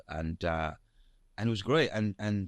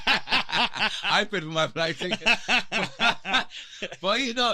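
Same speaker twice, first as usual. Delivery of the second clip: laughing; 10 semitones higher, 5 dB louder, slower than usual. Real, not acted. Laughing at flight ticket mixup.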